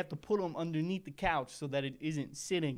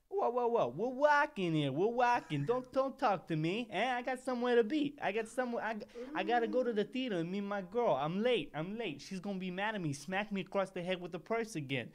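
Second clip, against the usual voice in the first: jittery mobster; 6 semitones higher than usual. Acted. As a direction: shoo him off, you're late for your girl. Brushing off stranger, high mafia voice.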